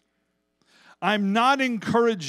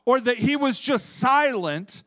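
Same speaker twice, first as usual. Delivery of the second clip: raised, insistent pulpit voice; emphatic preaching; 7 semitones higher, 5 dB louder than usual. Acted. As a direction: drive the argument home to the congregation.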